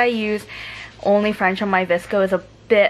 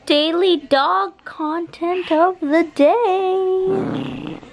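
announcer voice; 8 semitones higher, much slower than usual. Acted. Announcing dog segment.

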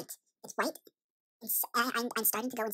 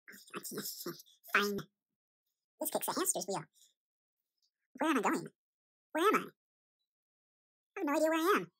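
ordinary delivery

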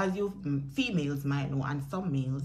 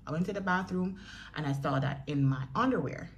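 ordinary talk

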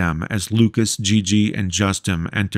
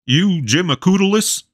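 character voice; 10 semitones higher than usual. A narrator's reading.

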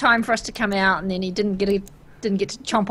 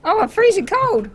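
high-pitched whine; 13 semitones higher, 6 dB louder than usual. Real, not acted. Character freezing in game.

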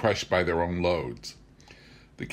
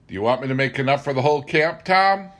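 higher, louder, quick mock-astonished ask; teasing question; 6 semitones higher, 6 dB louder, faster than usual. Narrator teasing Tom about enough eggnog for the whole camp, higher and louder. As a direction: tease him about the huge load.

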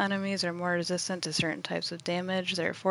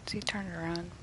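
whispering; 6 dB quieter, faster than usual. Real, not acted. Sneaking up on enemy.

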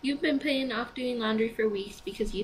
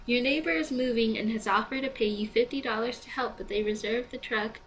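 normal talking voice

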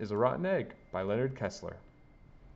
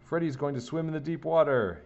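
higher-pitched mock yell of an announcement; excited yell; 7 semitones higher, 3 dB louder than usual. A narrator's reading. Bobby cheering on Freddy at the pool, excited mild yell. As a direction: call it out like proud news, lightly yelled. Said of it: "yelled Bobby".

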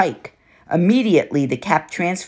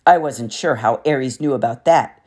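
ordinary delivery, nothing marked

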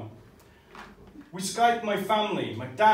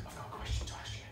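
breathy whisper, much softer; hushed nervous whisper; 14 dB quieter, much faster than usual. Retold or imitated. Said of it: "she's like".

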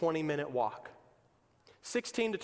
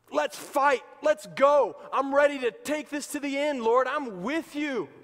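eager battle cry; 7 semitones higher, 6 dB louder than usual. Acted. Peter ready to fight.

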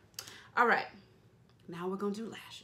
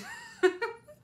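laughing; 7 dB louder than usual. Real, not acted. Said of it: "please don't be offended".

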